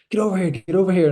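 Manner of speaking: high, loud, fast frantic yell; urgent retold call across the ward; imitated panicked shout. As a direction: bark it like a junior doctor losing his head. Panicked junior doctor at arrest.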